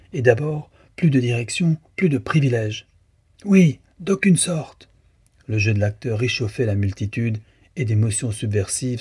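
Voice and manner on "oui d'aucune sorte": high, loud, slow shout; shouted agreement. A narrator's reading. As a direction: hammer it to the crowd. Crowd member shouts approval. (approbation criée, lente et forte)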